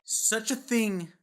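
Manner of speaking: booming movie-review hype; excited hype. Real, not acted. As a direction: sell it big to the viewers.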